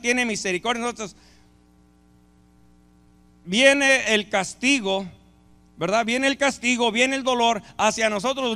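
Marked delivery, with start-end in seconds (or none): none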